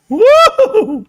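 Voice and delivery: a high, loud whoop of joy; real excited yell; excited woo. Real, not acted. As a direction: cheer the price going up. Cheering as the stock climbs.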